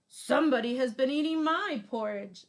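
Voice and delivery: a big papa bear, booming. Acted. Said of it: "said Papa Bear".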